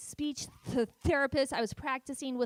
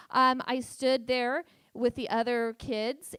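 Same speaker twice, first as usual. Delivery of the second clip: usual speaking voice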